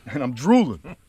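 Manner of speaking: slurred, drooling boxer impression; Rocky. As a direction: stay in the boxer character, drool through the words. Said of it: "That's how into character I get".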